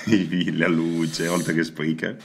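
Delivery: ordinary delivery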